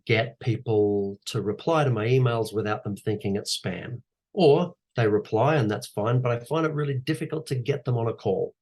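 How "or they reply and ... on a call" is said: usual speaking voice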